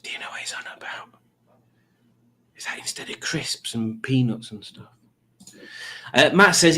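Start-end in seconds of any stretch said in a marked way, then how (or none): 0.0-4.9 hushed unvoiced aside; whisper. Real, not acted. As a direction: whisper it as a secret to the camera. Whispered aside about chatter.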